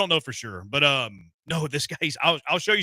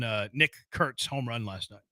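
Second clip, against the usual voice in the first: ordinary talk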